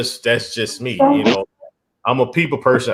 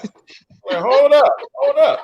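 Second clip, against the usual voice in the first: high-pitched, startled stop; taken aback; 12 semitones higher, 4 dB louder, much slower than usual. Acted. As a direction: halt her in comic alarm, pitched way up. Recoiling in acted club scene.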